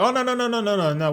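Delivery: high opening, rapid string of noes; retold refusal. Cambodian local turning down ringgit from a traveller, imitated hasty refusal. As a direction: imitate a seller waving away the offer.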